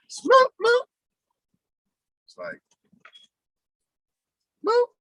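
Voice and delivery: high, loud mock horn; horn honk imitation. Explaining a goodbye honk, mock horn sound. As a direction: honk it like a car horn twice.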